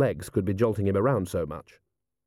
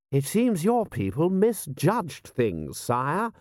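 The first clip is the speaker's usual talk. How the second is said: high, slow lordly voice; lord to his sire; 6 semitones higher, slower than usual. Acted. Lord pointing out a mistake to his prince, lordly. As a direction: draw it out high, as a lord to royalty.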